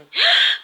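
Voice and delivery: loud high shocked gasp; sudden startled gasp; sharp gasp. Real, not acted. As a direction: gasp in alarm. Sees her crystal glasses.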